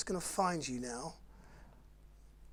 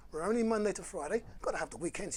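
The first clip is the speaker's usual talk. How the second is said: usual speaking voice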